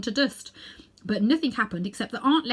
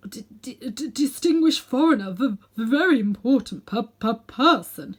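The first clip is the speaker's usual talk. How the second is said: frightened stammer, breaking louder and higher; 3 semitones higher, 6 dB louder than usual. A narrator's reading. Uncle Andrew stammering before Aunt Letty. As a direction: trip over every first sound in fear. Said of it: "he stammered".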